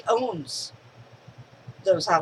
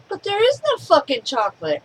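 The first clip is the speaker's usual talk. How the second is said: put-on high, louder exasperated kid protest; frustrated kid; 8 semitones higher, 5 dB louder than usual. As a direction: blurt it out like a fed-up boy. Kid's frustrated outburst.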